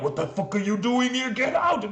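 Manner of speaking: half-hearted pretend outburst; imitated angry yell. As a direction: chase the intruder off, hammed up. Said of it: "he's not even like".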